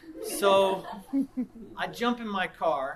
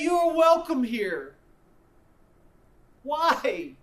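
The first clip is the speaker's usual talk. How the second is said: loud, higher, pleading preacher's questions; impassioned preaching; 7 semitones higher, 11 dB louder than usual. Acted. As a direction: preach it, pleading at the whole room. Preaching at full force.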